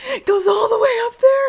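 high, loud disbelieving squeal; mock-horrified high shriek; shocked teen. Girl shocked, retold.

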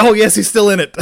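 very high, loud re-enacted cheer; elated relief. Friends seeing he's still in it, elated relief. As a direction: burst out like a thrilled fan. Retold fans cheering the comeback.

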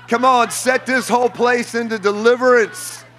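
high, shouted preacher's cry over music; fiery revival preacher declaring deliverance; preaching at full force. Acted. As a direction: proclaim freedom over the crowd, full voice.

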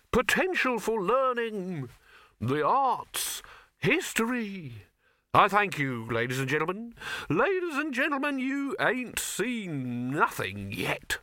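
high, slow grand showman. Acted. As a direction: proclaim it like a carnival barker. Showman Silverfish announcing.

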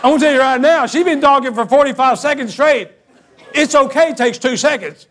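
high, loud comic incredulity; retold loud protest. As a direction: argue it hard, playing up the outrage. Acted argument with neighbour.

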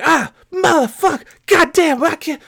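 high, strained trucker cursing; frustrated cursing. Retold or imitated. Trucker struggling with his rig, frustrated cursing.

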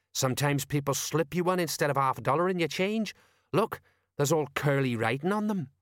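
know-all sergeant; Colon. Acted. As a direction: play the old hand who knows.

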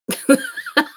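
helpless high laughter; high burst of laughter. Real, not acted. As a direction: let a shrieking laugh escape.

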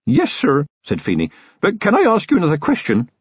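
put-on a nervous young local constable, polite; young constable Feeney. A narrator's reading. Young constable urging Commander Vimes to leave, earnest and polite.

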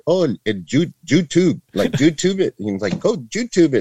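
high, playful mocking jab; teasing dad. Dad laughing at his son for needing YouTube, high and playful.

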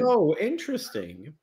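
high mock-polite "oh, interesting"; a lightly amused put-on voice for Dennis; imitating Dennis. Recounting how Dennis reacted to the theory, high mock voice. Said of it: "Dennis was like".

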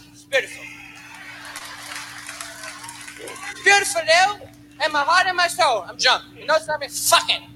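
put-on slurred, belligerent drunk dad; drunk father. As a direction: rant like a drunk father at home. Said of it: "My pop's home like this drunk".

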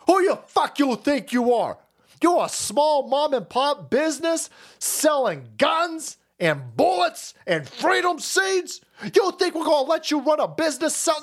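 high-pitched, hammed-up berating of a shop owner; mock indignation. Mocks officials berating a small business.

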